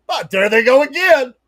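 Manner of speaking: mock exasperation at hosts; exasperated Steve. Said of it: "And then he goes".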